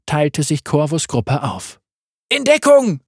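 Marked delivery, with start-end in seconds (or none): 2.3-3.0 high loud cry for cover; shouted warning. A narrator's reading. Corvus warns soldiers as fire rains down, shouting. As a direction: yell at them to take cover. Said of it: "rief er".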